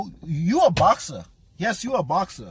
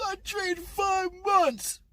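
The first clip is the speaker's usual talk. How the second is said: much higher, slow, wailing mock cry; mock wailing boxer; 11 semitones higher, 4 dB quieter, much slower than usual. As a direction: wail it like a crybaby, stretched out. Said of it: "the allusion to his crying is".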